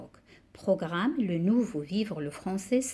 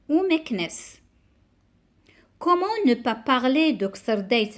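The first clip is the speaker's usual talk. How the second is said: poem recitation; 2 semitones higher, 4 dB louder than usual. Acted. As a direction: recite it line by line, pausing after each verse. (récitation de poème, déclamée avec emphase)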